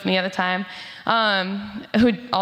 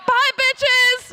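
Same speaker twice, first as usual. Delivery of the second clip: much higher, louder put-on voice; mock gleeful yell; 12 semitones higher, 4 dB louder than usual. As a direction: cackle it as you speed off. Friend zooms off in wheelchair.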